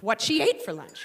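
usual speaking voice

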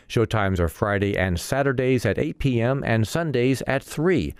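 measured announcer reading of event listings; radio calendar read. Real, not acted. Host reads events calendar.

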